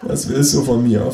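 understated weary suffering; tormented. A narrator's reading. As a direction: ask as if worn down by torment. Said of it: "frage ich gequält".

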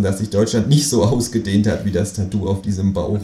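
ordinary delivery

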